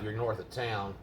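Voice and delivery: ordinary talk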